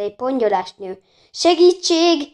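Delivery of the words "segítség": high, loud shriek for help. A narrator's reading. Woman in a dressing gown fleeing a pursuer, shrieking. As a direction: shriek it out, falling off at the end. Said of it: "visította".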